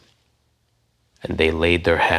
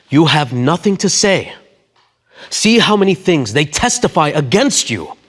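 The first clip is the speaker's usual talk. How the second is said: louder, higher challenge; pressing; 7 semitones higher, 7 dB louder than usual. Acted. Pilate pressing silent Jesus, raised voice. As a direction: demand a reply.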